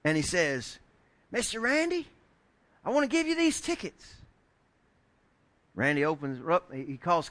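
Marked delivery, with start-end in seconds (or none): 1.3-4.2 put-on high child's voice, earnest; a small boy politely offering tickets; polite little boy. Boy offering tickets to his friend's dad, high polite child voice.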